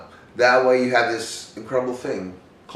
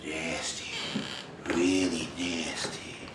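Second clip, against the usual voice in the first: very soft, slow menacing near-whisper; mean, nasty; 5 semitones lower, 10 dB quieter, much slower than usual. Shown as a demonstration. Coach showing actors a nasty look, menacing whisper. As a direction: let it sink to a slow, sinister whisper. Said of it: "a guy that looks mean".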